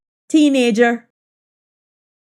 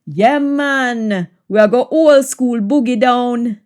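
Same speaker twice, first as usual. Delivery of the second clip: lively Jamaican patois. Shown as a demonstration. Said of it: "patois version of the line".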